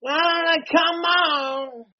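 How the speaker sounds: high, cheering yells; excited cheering. Real, not acted. Pouring a drink for Ozzy, high cheering yells. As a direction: whoop it up.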